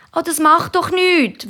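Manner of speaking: hohe, gedehnte Kinderstimme; wehrt sich. A narrator's reading. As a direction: wiegle hoch und langsam ab wie ein Kind. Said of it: "wehrt sich Tamara". (hochi, dehnti Meitlistimm)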